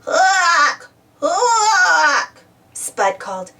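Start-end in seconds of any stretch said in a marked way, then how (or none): 0.1-2.4 high, loud drawn-out squawk; scary call. A narrator's reading. Spud trying to scare Muck, spooky squawking. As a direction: hoot it out to frighten. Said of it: "making another scary sound".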